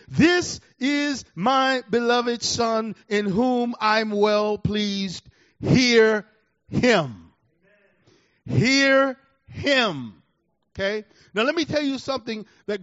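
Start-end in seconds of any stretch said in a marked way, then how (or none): 0.1-7.2 slow, booming voice from the cloud; voice of God. Acted. Preacher voicing God from the cloud, slow and booming. 8.4-10.1 HEAR him, loud and falling; emphatic command. Real, not acted. Preacher driving home God's command, loud.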